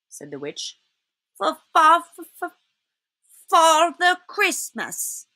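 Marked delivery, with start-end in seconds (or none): 1.4-5.3 high, halting, frightened fox; stammering fox. A narrator's reading. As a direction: stammer out the name, dragging the last words. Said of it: "stammered the fox".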